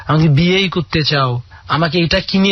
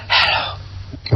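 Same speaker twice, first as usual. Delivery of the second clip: slow, breathy whispered hello; 5 dB quieter, much slower than usual. Shown as a demonstration. Teaching his girlfriend to talk secretly on the phone, slow whisper. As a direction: whisper it so nobody nearby hears. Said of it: "এভাবে কথা বলবা".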